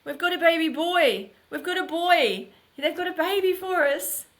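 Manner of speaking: put-on husband telling his wife about their baby, elated and high; breathless good news. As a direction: pour out the news, barely containing joy. Husband relays adoption news.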